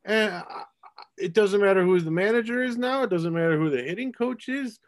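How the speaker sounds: high-pitched, baffled exasperation; flabbergasted. Real, not acted. Podcast host venting about his team's hitting, flabbergasted. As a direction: throw up your hands in bafflement, pitch climbing. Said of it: "I'm just flabbergasted".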